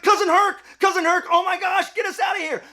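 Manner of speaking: high, loud excited pleading; overjoyed, panicky shouting; frantic relief. Acted. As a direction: call out desperately to a rescuer. Trapped hero begs rescuer.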